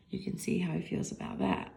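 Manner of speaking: soft whispered aside. Real, not acted. Reader whispering to listeners about the sad dog. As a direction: lean in and whisper it. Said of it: "reader aside".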